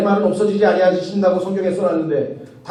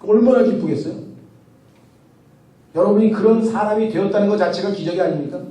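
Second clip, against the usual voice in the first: normal talking voice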